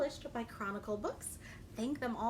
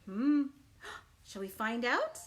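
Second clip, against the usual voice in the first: ordinary delivery, nothing marked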